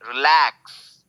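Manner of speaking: Relax with quick री, long lakhs; second-syllable stress. Shown as a demonstration. Teacher pronouncing relax correctly, stretched LAX. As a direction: stretch lakhs, barely touch the रि. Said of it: "lakhs को खींचा जा रहा है".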